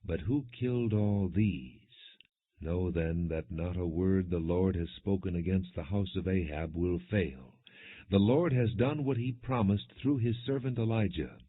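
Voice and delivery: ordinary delivery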